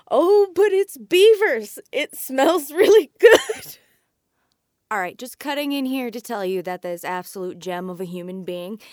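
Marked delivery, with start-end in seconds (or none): 0.1-3.8 higher, louder and slow, savoring each word; delighted quote. Retold or imitated. Wildlife ecologist telling doubters, slow and gushing. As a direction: gush about the lovely beaver smell. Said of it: "And I tell 'em".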